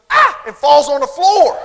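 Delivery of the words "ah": short high-pitched mock shriek; sudden hammed-up kid scream; child's scream. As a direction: shriek loudly like a little girl. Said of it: "the little girl goes".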